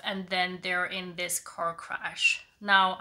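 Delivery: ordinary talk, nothing marked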